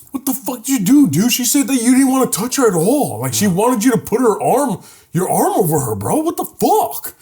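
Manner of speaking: high-pitched mock outrage; incredulous friend chewing him out; retold exasperated scolding. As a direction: scold a clueless buddy in disbelief. Retold friend confronting him over date.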